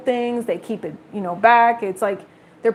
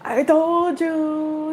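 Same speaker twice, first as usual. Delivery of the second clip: sing-song slow told-you-so; slow drawn-out gloat; 6 semitones higher, much slower than usual. Real, not acted. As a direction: stretch it out slow and high. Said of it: "card reappeared".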